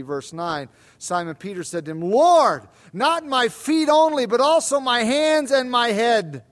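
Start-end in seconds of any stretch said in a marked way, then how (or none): 2.0-6.4 higher and louder, an urgent rush of eagerness; eager plea. Acted. Peter pleading with Jesus to wash him all over, eager. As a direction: beg eagerly, voice rising.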